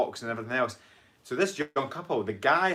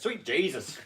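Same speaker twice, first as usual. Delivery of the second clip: higher-pitched mock Southern American; Southern American accent; 4 semitones higher than usual. Redoing viewer's comment for co-host, jokey Southern American accent. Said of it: "a Southern American accent".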